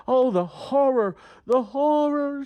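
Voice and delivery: mock horror. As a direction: ham up the horror for the kids. Mock dismay in kids' story.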